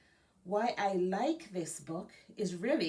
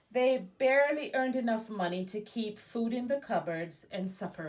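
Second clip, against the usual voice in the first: lively storybook narration, a bit louder; reading to children; 3 semitones higher, 4 dB louder than usual. A narrator's reading. Librarian reading a biography aloud to Girl Scouts, lively storybook voice. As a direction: read it like a picture book to kids. Reads picture book aloud.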